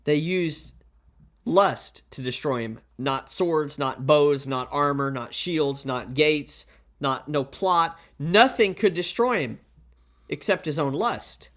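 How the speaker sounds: normal talking voice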